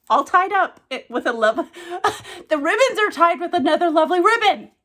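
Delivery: high, bubbly and overjoyed, laughing in between; gushing excitement. Real, not acted. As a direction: squeal over the ribbons in delight. Gushing over gift ribbons.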